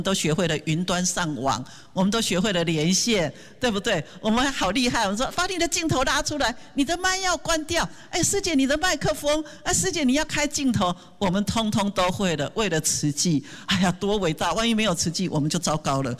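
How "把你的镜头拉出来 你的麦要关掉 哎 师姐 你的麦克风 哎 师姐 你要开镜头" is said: high-pitched calling out to fellow members; bossy online host. Retold or imitated. Volunteers ordering sisters on video calls, in a bossy high call. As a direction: chivvy everyone like a meeting host.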